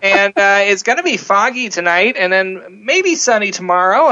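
put-on young DJ reading the weather; high school voice. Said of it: "in my little, uh, you know, high school voice and go".

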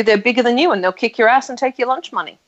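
usual speaking voice

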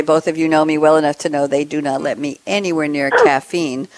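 normal talking voice

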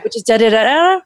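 da da da rising sharply at the end; sing-song rise on the last da; up talk demo. Shown as a demonstration. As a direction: show the upward lilt on the final da. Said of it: "listen for the up talk".

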